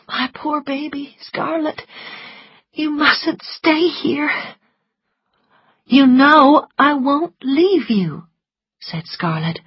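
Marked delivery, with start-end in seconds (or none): 0.1-4.3 weak and tearful. A narrator's reading. Weak Melanie to Scarlet. 5.9-8.3 slow, louder reassurance; firm and sincere. A narrator's reading. Scarlet insists.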